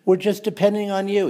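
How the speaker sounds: high falsetto parody of meek compliance; mock obedient citizen. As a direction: squeak it out like a sheepish yes-man. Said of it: "where they just go".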